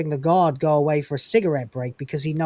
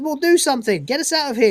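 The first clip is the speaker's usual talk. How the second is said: mock panicked plea; 8 semitones higher, faster than usual. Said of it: "It says".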